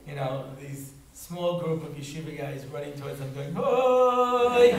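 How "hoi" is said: sudden high, loud cry of "Hoi!"; battle cry. Retold or imitated. Yeshiva boys running at the Greek platoon, loud battle cry. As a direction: yell it like a charging war cry.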